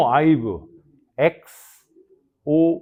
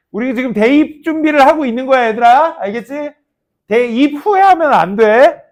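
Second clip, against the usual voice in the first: raised voice, urging students; louder and higher, drive the point home; emphatic scolding; 8 semitones higher, 9 dB louder than usual. Real, not acted. 학생들에게 강조. (대입 준비하라고 학생들에게 큰 소리로 강조)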